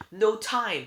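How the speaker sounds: high, very loud outburst; urgent cry. A narrator's reading. Tempest refusing to explain, urgent cry. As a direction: cry it out in a rush of alarm. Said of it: "Tempest cried".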